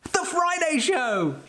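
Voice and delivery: big slow showman announcement; announcer voice. Acted. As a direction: announce it like a game show host. Show intro announcement.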